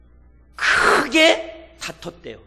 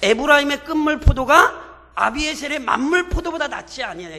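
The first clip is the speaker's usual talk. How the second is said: grape verse raised higher and louder, pleading; Gideon placating; 5 semitones higher, 5 dB louder than usual. Retold or imitated. Gideon flattering Ephraim to cool their anger, raised pleading. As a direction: lift the line up to flatter them. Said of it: "그러면서 이런 얘기 하잖아요".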